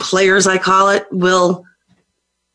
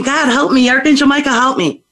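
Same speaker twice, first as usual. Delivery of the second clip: calling out for help; 8 semitones higher than usual. Retold or imitated. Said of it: "I was screaming".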